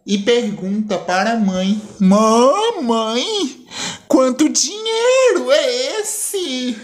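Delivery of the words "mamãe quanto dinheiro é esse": put-on high, slow girlish voice; a young girl's voice, pitched up high; daughter's voice. Daughter asking her mother, in a high girlish voice.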